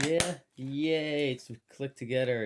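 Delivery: ordinary delivery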